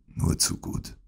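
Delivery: soft, breathy sickbed reply; feeble whisper. Acted. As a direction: whisper it weakly. Sick Holmes answers feebly. (schwach geflüstert)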